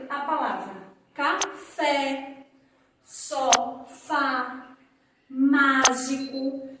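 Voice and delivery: café, sofá, mágico with the accented vowel held wide open; teacher modelling open vowels; open vowel demo. Shown as a demonstration. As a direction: stretch the open é and á, slowly. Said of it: "esse acento, ele tem uma pronúncia aberta".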